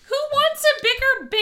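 high, loud laughing question. Real, not acted. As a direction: say it while laughing.

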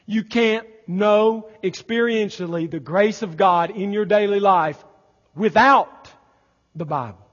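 full-force pulpit exclamation; fervent preaching. Acted. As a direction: preach it with mounting awe. Loud preaching point.

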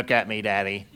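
low, insistent little sidekick; cartoon sidekick voice, low and insistent; Disney sidekick voice. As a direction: imitate a toddler demanding attention, pitched low. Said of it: "It was a Disney sidekick voice".

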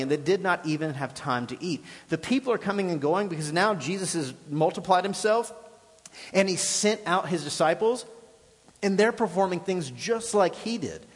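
normal talking voice

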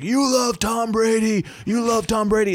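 put-on high, slow taunting drunk voice; drunk heckler taunt. Drunk football fan taunting the Boston comic, high and slow. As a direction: drag it out like a drunk fan mocking. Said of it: "all the guy needed to hear is like".

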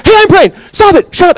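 put-on high, loud bossy kid yelling; kids fighting. Sibling telling the other to stop, high and loud. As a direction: yell like a cross little kid. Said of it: "They go".

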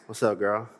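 imitating a smooth late-night flirt; late-night smooth guy. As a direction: play the guy calling at two A M. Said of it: "He wasn't talking like that at two P M".

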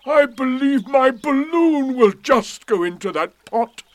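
loud, high-pitched delight; excited. A narrator's reading. Eeyore realising his balloon fits the pot, excited. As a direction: exclaim it at full excitement. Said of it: "he became quite excited".

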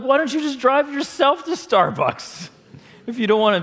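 high-pitched comic exasperation; acted-out frustrated outburst. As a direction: vent mock indignation at your friend. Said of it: "I was frustrated".

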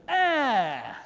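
high, loud mock tantrum shout "Ah!"; angry toddler yell. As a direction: imitate a stamping little boy's cry. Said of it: "He stamps his feet and he goes".

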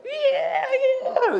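very high, thin squeal of joy. Retold or imitated. Pastor imitating his friend squealing on the jet ski, high squeal. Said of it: "he's squealing".

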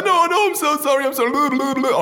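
high, fast mock apology; frantic grovelling. Sacked dentist placates scolding boss, frantic high grovelling. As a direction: gabble apologies in a squeaky high voice.

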